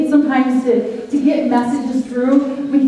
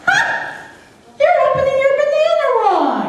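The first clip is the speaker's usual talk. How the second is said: put-on much higher jeering kid voice; boys jeering. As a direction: gang up and jeer like schoolboys. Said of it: "they're laughing at him".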